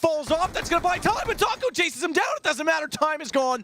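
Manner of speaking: high, hyped play-by-play shout; excited shouting. Real, not acted. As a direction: yell it as the clutch unfolds. Caster erupts on clutch play.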